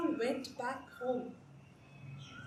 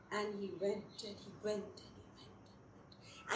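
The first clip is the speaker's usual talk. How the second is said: softer and softer until whispered; fading into whisper; 6 semitones lower, 14 dB quieter than usual. A narrator's reading. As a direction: let it fade out like footsteps far away. Running far, fading away.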